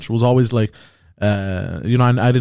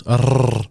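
a rolled trill demo; 2 semitones lower than usual. Shown as a demonstration. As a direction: roll the R hard. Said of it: "you have to roll your R's".

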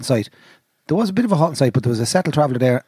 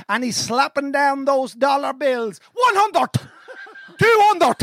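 put-on high, slow recitation in a Cavan accent; 7 semitones higher, slower than usual. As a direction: intone it dramatically in broad Cavan. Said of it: "in the Cavan accent".

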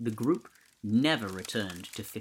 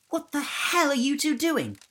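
put-on high, loud girl's voice, baffled; confused Ellie; 8 dB louder than usual. A narrator's reading. Ellie baffled at the pile-up, high and loud. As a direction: Ellie startled as the boys crash in. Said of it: "confused as to why".

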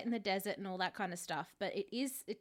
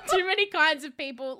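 laughing; 6 semitones higher, 10 dB louder than usual. Real, not acted. Laughing mock eugenics joke.

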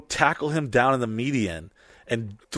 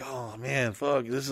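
reluctant sigh; 8 dB quieter than usual. Retold or imitated. Retold cop torn about arresting him.

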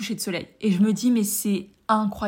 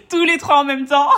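laughing, high and loud; en riant; 6 semitones higher, 9 dB louder than usual. Real, not acted. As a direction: burst out laughing mid-phrase. Laughs recalling mixing three languages. (en éclatant de rire)

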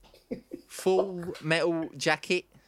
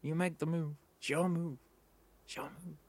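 a hushed mock movie hard man; Robert De Niro; 8 dB quieter than usual. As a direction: drop the voice away to nothing, threatening. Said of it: "It's really hard to do a good Robert De Niro".

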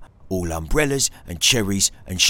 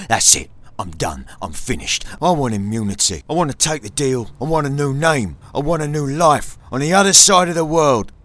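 higher, building to louder on the last want; desperate plea; 10 semitones higher, 3 dB louder than usual. Retold or imitated. Informant pleads with his boss, rising higher and more urgent.

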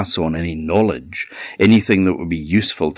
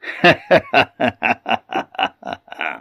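a higher, odd-voiced chuckle from the merman; merman's laugh; 4 semitones higher than usual. The merman laughs at the cursing fisherman, an eerie chuckle. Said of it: "And then the merman laughed".

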